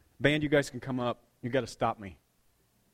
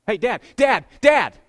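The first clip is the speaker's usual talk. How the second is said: loud, higher repeated calls; kid calling for dad; 6 semitones higher, 14 dB louder, slower than usual. Retold or imitated. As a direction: shout to get dad to look up. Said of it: "they'd do this".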